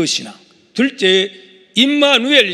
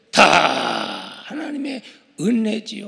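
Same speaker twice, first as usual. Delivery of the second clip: ordinary delivery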